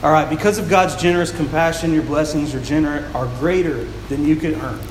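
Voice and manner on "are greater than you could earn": normal talking voice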